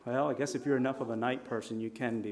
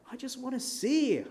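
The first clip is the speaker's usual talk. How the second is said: much higher, thin plea; pleading blind man; 11 semitones higher than usual. Acted. Blind Bartimaeus begging Jesus to see, pleading high. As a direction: beg like a desperate blind beggar.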